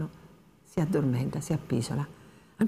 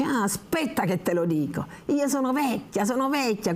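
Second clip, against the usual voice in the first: put-on high, resentful grumble of an old woman; she's cross and complains, pitched up; angry old woman; 7 semitones higher than usual. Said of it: "La vecchia si arrabbia". (la vecchia arrabbiata, voce alta e astiosa)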